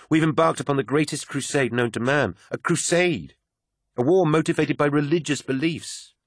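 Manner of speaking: a hint of a zealous woman, earnest; fervent believer. Euphrates voiced, fervent preaching.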